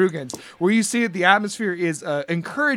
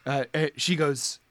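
ordinary delivery

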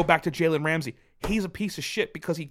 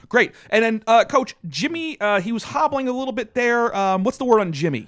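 louder, higher mock sideline reporter; halftime interviewer; 6 semitones higher, 7 dB louder than usual. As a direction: pretend to interview a coach at halftime. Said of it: "let's conduct a, a normal halftime interview".

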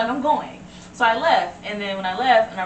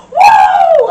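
piercing, sustained high whoop; imitated crowd cheer; 16 dB louder than usual. Recalling everybody at the gas station, loud high whoop. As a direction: let out a loud party "woo".

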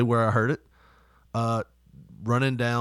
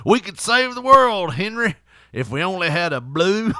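mock grand speech; 6 semitones higher, 5 dB louder than usual. Imagined burly men's conversation.

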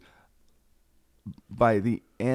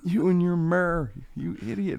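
mock scolding; 8 semitones higher than usual. Mocking the myrrh-giving wise man.

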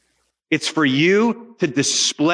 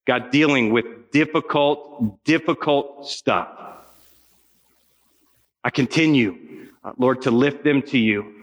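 closing prayer; 5 semitones lower than usual. Real, not acted. Preacher prays over students.

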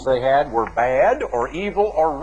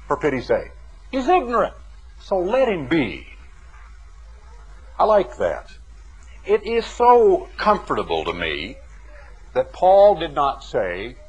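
normal talking voice

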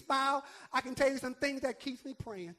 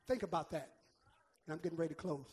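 normal talking voice

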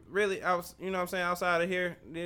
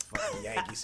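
mock Southerner. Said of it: "they was like".

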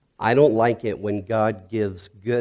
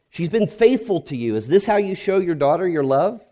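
higher, strained accusation at God; raised, anguished protest to God; angry prayer; 9 semitones higher, 3 dB louder than usual. Retold or imitated. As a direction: confront God over his burned wife. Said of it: "growled at God".

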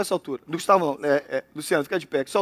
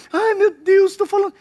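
mock panic; 9 semitones higher than usual. Fretting over gossip.

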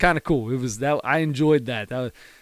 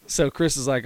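usual speaking voice

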